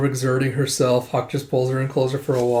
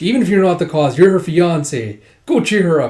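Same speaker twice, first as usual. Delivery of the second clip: lightly acted, louder scolding; a touch higher and insistent, ordering him off; scolding grandpa; 4 semitones higher, 6 dB louder than usual.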